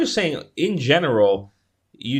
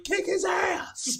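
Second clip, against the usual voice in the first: much higher, slow, excited cheer; 6 dB quieter, much slower than usual. Retold or imitated. Viewer super into the fight, cheering Inigo on. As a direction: egg him on like a fan in the stands. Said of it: "You're like".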